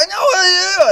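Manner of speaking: very high, loud squealing accusation; mock Chinese family shouting. Family yelling at him in their bunk, mock shout. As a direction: shriek it at the stranger. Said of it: "They're like".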